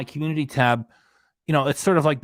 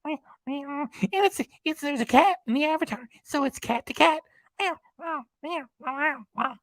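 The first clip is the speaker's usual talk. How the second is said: squeaky high cartoon cat. Cat voice continues.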